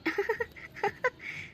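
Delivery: high-pitched burst of laughter; acted high laugh. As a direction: laugh lightly, high and wordless. Laughs after the son's introduction.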